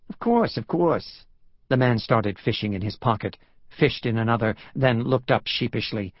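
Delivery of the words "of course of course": placating New Yorker, high and slow; drawn-out, obliging New York accent. Acted. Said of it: "His New York accent grated".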